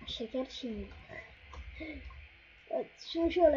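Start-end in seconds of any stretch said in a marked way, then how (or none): none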